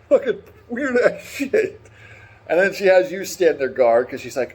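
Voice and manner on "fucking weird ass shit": laughing. Real, not acted. Laughing at absurd quest.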